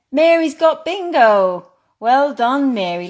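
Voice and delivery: high, loud win announcement; excited. Real, not acted. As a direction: cheer the winner. A player wins bingo.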